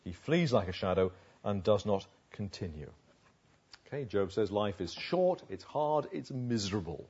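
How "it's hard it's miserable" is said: usual speaking voice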